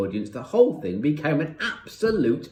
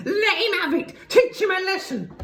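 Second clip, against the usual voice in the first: high-pitched excited shriek; Missus Pratchett; 4 dB louder than usual. A narrator's reading. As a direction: shriek to urge the beating on. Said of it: "she was shrieking".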